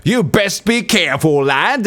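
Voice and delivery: hammed-up British lowlife accent; mock British accent. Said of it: "Such good accent".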